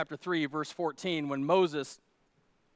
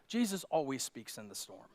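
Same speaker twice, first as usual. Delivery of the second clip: soft statement; 5 semitones lower, 7 dB quieter than usual. Shown as a demonstration. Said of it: "Let me say this".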